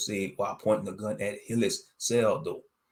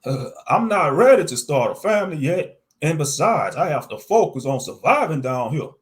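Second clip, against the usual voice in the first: high, louder nervous voice; 4 semitones higher, 7 dB louder than usual. Acted. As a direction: stammer it out uneasily. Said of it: "I said to him nervously".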